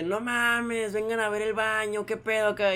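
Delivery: drawn-out, mild mock call; alarmed call. Supervisor summons coworkers to bathroom, toned-down alarmed call. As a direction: stretch it out like a half-hearted yell.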